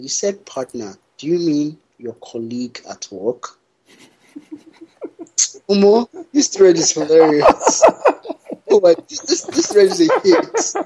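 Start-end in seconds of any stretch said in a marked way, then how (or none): 5.5-10.9 laughing. Real, not acted. Laughing at the thread.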